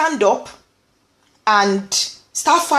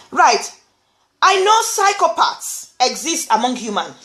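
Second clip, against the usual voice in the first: usual speaking voice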